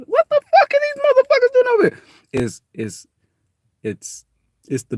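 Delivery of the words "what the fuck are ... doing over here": very high, loud squeal of disbelief; startled kid. Retold or imitated. Kids in the neighborhood spotting an outsider, very high and loud. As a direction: shriek it like a kid seeing strangers.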